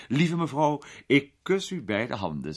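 lightly voiced gallant lord. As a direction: sweep into the gallant greeting. Peter voiced in story.

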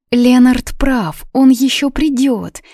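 high, hesitant reassurance; unsure. A narrator's reading. Полли tries to comfort Дана, unsure. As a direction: reassure without full conviction. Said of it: "неуверенно пробормотала добрая Полли".

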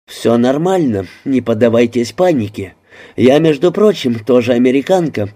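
usual speaking voice

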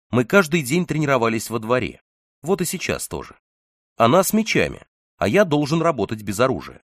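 usual speaking voice

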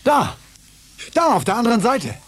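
high, loud pointing-out cry; girl spotting her friend across the dome, excited call. Acted. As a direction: shout it as you spot him. Spotting friend through tunnel.

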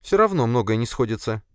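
high female-character voice, objecting; Sofia, insistent. Acted. Sofia argues case with boss, insistent.

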